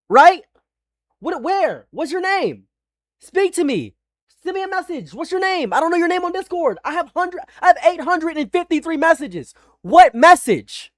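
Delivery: high-pitched, agitated interrogation; angry ranting. Real, not acted. Streamer demands to know about a message, angry ranting. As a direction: fire questions in a high, heated voice.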